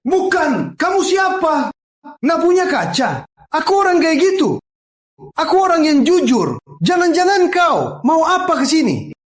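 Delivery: very high, agitated outburst; indignant protest. Acted. Speaker challenging an unwanted visitor, high and indignant. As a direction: protest at a high pitch, flustered and accusing.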